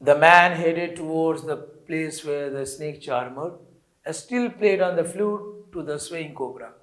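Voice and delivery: slow, deliberate read-aloud for a class; slow story reading. Acted. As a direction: read each English phrase very slowly and clearly. Teacher reads story text aloud. (धीमी गति से कहानी पढ़ना)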